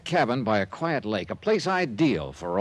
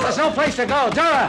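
loud high shout after a fleeing woman; calling out; 5 dB louder than usual. Acted. Investigator chasing the fleeing woman, shouting. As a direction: holler her name across the dark. Johnny chases her.

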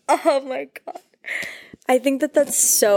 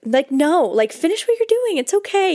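emphatic no; 9 semitones higher than usual. Real, not acted. Coaching herself, calling out.

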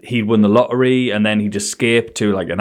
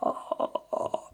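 breathy unvoiced stammer; lost for words, whispered; helpless whisper; 17 dB quieter, much slower than usual. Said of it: "I went".

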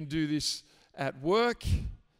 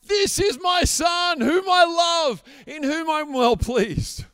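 much higher, loud joyful proclamation by the Father; excited shout; 8 semitones higher, 8 dB louder than usual. Retold or imitated. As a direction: shout it out like a proud dad. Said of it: "He is shouting his praise over us".

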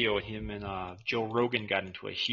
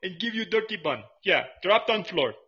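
higher, louder broken-English shopkeeper voice; hot dog vendor character; 10 semitones higher, 5 dB louder than usual. Acted. Vendor taunting the customer, higher and louder in broken English. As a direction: haggle like a cheeky street vendor.

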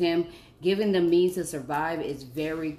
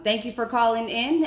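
ordinary talk, nothing marked

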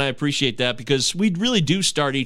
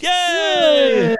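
cheer; 13 semitones higher, 6 dB louder than usual. Real, not acted. Celebrating a show first.

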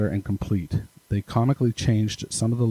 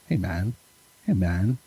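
laid-back greeting; slower than usual. Shown as a demonstration. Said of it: "I can just tell the way he wrote it".

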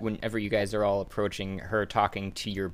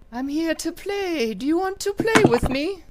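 too calm creepy doll; 7 dB louder than usual. Acted. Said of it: "gives you the biggest eyes".

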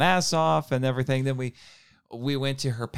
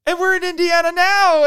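very high, loud excited squeal; freaking out; 6 dB louder than usual. Retold or imitated. Fan realising where they are meeting the actor, freaking out. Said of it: "I was just freaking out".

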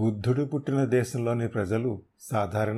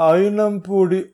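high, loud, slow naming; 7 semitones higher, 8 dB louder, slower than usual. Acted. Terrified thug revealing the name, loud slow naming. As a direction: spell out the name loudly.

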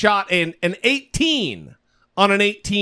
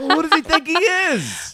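much higher, indignant Trump voice; Trump impression; 8 semitones higher than usual. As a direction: sneer like an offended president. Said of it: "Oh, that's an amazing impression".

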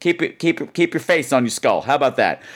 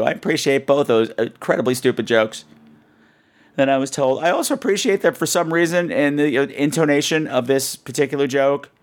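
normal talking voice

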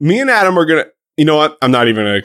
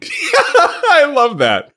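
cracking up while speaking; giggling through the words; laughing; much slower than usual. Real, not acted. Laughing at partner in character.

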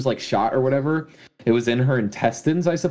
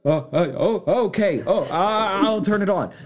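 jittery, higher mock voice of a panicking robber; nervous robber; 5 semitones higher than usual. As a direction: stammer it out like a caught thief losing his nerve. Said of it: "And he's like".

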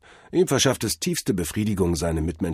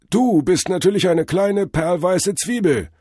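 high, loud guru pronouncement; jive-talking drug-vision god; 11 semitones higher, 6 dB louder than usual. A narrator's reading. God reveals to Cordell he is an onion, high guru. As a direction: proclaim it as a trippy god, slowing at the end. Figure of Tod Hermes.

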